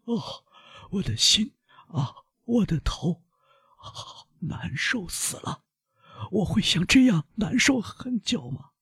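high, strained, pained voice fading to a whisper; pained cries. Acted. Dying servant begging the doctor, high strained agony. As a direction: cry out in pain, then slow and breathless.

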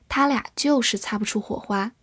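ordinary delivery

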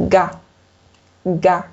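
嘎 with no puff of air released; unaspirated sound. Shown as a demonstration. French teacher demonstrates the second sound, 嘎 unaspirated. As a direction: say 嘎 with the airflow held back. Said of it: "气流是不应该出来的".

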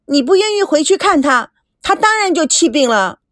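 put-on high, insistent girlish cry; calling out loudly. A narrator's reading. Girl crying out at grandfather's back, accusing. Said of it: "大声地叫着".

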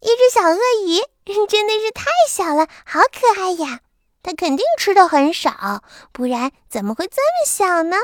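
put-on high girlish voice, delighted; little girl. Girl fusses over the cute crocodile, high delighted voice. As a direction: coo over the tiny crocodile like a small girl.

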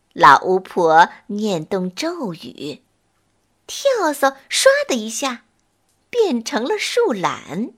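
usual speaking voice

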